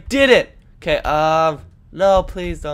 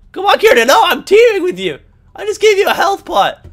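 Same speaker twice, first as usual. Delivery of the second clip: whiny complaint; 12 semitones higher, 5 dB louder than usual. Real, not acted. Complaining to teammate.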